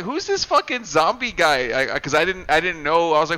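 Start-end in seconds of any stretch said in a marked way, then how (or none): 0.0-1.7 higher-pitched mock bewilderment; baffled outburst. Viewer recalling first sight of the zombie, acted-out bafflement. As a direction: retell your baffled reaction, pitched up. Acts out baffled reaction to film.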